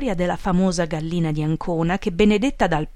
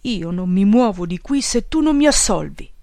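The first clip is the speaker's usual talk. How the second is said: mildly louder, stubborn grumble; grumbled, moaning; 2 semitones higher, 4 dB louder than usual. A narrator's reading. Big follower refuses to budge until absolved, grumbling. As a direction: dig in with a grumbling protest. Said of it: "mugolò".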